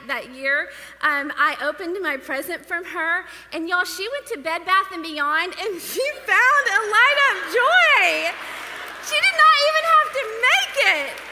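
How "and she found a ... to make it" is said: much louder and higher, thrilled; delighted. Real, not acted. Telling the crowd about her sister's light-up gift, thrilled. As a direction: burst out with glee at the gift.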